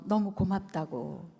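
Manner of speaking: normal talking voice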